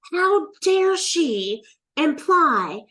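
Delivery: loud, high, drawn-out indignant outburst; livid. Retold or imitated. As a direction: re-enact being outraged, hitting each word hard. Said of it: "I was livid. I was so mad".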